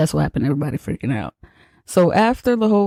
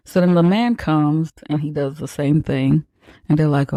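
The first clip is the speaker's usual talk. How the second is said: normal talking voice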